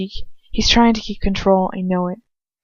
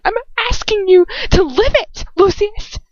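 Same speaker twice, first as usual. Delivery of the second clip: high, tearful plea; shaking with emotion; 13 semitones higher than usual. Acted. Hermione begging Lucius to live, tearful. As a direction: voice breaking as she begs. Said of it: "shaking with emotion".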